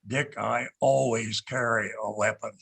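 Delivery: low, gravelly agent imitation. Agent reassures deputy director about his weapon, low and gravelly. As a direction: imitate a gravel-voiced man, pitched low. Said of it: "a very gravelly voice like this".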